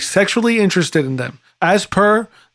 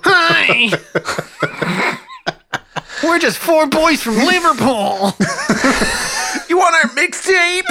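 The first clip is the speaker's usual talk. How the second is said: higher put-on Scouse voice, slow and laughing; 8 semitones higher, much slower than usual. Playing the Beatles.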